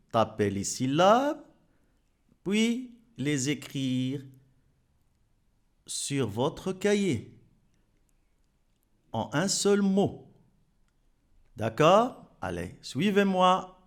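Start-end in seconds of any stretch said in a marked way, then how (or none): none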